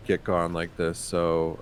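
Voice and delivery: ordinary talk, nothing marked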